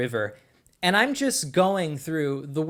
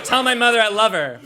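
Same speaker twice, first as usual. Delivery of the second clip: mock deathbed line; 7 semitones higher, 7 dB louder than usual. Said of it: "a touching Saving Private Ryan moment".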